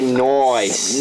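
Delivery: "nice" drawn out as "noice". Shown as a demonstration. Showing friends a favorite way of saying it, "nice" as long "noice". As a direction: say "nice" with an oy vowel, stretched long. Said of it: "I always put O's in there".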